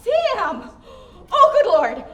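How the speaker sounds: loud, startled outburst; alarmed dismay. Acted. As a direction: blurt it out loud, caught off guard. Caught off guard by lover.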